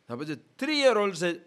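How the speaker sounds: usual speaking voice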